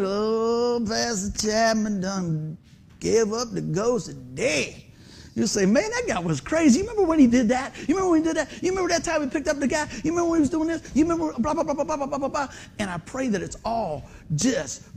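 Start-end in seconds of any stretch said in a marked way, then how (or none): 0.0-4.8 slow, drawn-out mock lament; mock mourner. Mourner announcing the pastor's death, mock lament. 5.7-12.5 high-pitched, tumbling mock chatter; excited reminiscing. Funeral guests swapping stories about the pastor, mock excited chatter.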